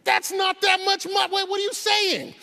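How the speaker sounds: high, loud, incredulous outburst; exasperated shout. Real, not acted. Lecturer loudly rebuts critics, high and exasperated. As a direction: shout your disbelief at the crowd.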